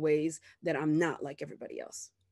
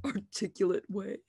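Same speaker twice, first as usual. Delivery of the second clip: ordinary delivery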